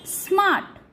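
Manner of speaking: स्मार्ट with a long open आ. Shown as a demonstration. Teacher giving an example to learners, स्मार्ट with long आ. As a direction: draw the A in स्मार्ट out as आ. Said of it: "आ के रूप में भी".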